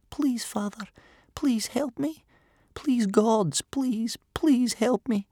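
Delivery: put-on high, whispery little boy begging; silent repeated plea. A narrator's reading. Boy prays inwardly to father and gods, whispered begging. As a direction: plead over and over in a high, breathy whisper. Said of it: "inside his head, he repeated over and over".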